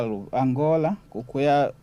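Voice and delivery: normal talking voice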